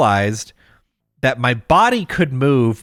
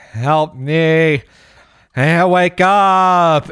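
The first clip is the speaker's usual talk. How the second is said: dream voice; 2 semitones higher, much slower than usual. Retold or imitated. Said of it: "I hear my voice go".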